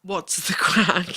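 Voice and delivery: put-on slow, half-hearted Irish accent. Guest reluctantly performs on host's request, weak Irish accent. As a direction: attempt an Irish accent, drawn out slowly. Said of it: "in Irish".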